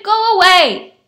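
loud, high shout; shouted refusal. A narrator's reading. Neighbor sending the callers off, high loud shout. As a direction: shout them away. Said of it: "she shouted".

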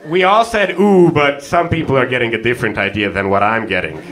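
normal talking voice

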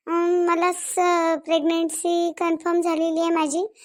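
hesitant confession. Acted. Patient asking the doctor for an abortion, hesitant confession. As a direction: draw the words out reluctantly.

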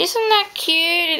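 high, slow, cooing delight; drawn-out cooing praise; delighted gush. Real, not acted. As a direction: coo over the doll with pride. Showing off the finished bloomers.

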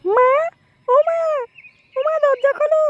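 high, drawn-out call for mother. Acted. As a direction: call through a closed door to be let in. Daughter calling at the door. (দরজার বাইরে থেকে ডাকা)

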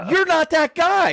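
high, loud startled realization; sudden realization. Retold or imitated. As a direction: it dawns on him all at once.

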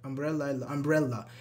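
normal talking voice

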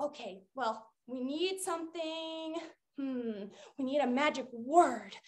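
low, very soft pondering; musing aloud. Pretend. Cat pondering how to make a gift, quiet musing. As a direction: think it over quietly to yourself. Thinks aloud about what's needed.

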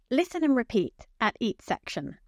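normal talking voice

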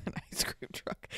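whispered through giggles; hushed laughing. Real, not acted. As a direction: giggle it out under breath.

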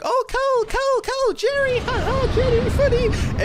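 very high squeaky mocking voice; exaggerated high-pitched mock impression; mimicking the chat. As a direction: parrot them in a silly, sky-high voice. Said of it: "Everyone keeps saying".

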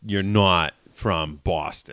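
slow Boston accent demo. Shown as a demonstration. Guest coaching host on the O's, slow Boston accent. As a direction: slowly lean on the broad Boston O's. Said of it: "just the O's".

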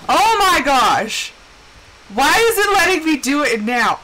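outraged it works now; exasperated surprise. Real, not acted. Cart finally flips, loud outraged surprise. Sudden success exclamation.

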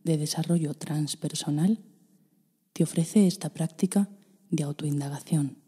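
clear, measured program announcement; announcer intro. Acted. School introducing its practice to the listener, measured announcer read. Spoken intro before meditation.

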